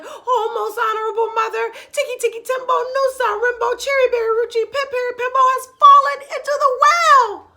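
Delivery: high, loud panicked calling; frantic shout. Acted. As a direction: shout it across to mother in a panic. Chang shouting to distant mother.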